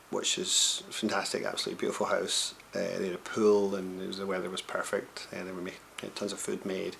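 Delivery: quiet, low recap; hushed voiceover. Real, not acted. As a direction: murmur it like a late-night diary.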